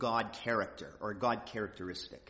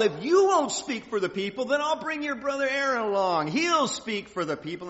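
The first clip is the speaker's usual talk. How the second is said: raised, higher and louder scolding voice; ticked off; 8 semitones higher, 8 dB louder than usual. Retold or imitated. God telling Moses to bring Aaron, impatient and loud. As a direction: voice God's impatience, loud and pitched up. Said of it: "he got a little ticked".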